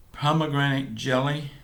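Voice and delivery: ordinary talk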